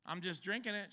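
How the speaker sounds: ordinary delivery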